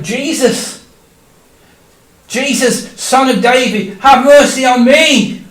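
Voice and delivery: calling out loud and high over a crowd; desperate shout. Acted. Blind beggar shouting to Jesus over the crowd, desperate loud plea. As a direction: shout for attention, pleading. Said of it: "I started to shout out".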